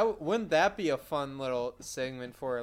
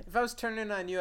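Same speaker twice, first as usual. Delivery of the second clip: ordinary talk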